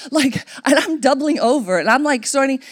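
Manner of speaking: normal talking voice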